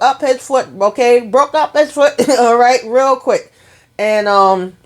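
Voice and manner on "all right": ordinary talk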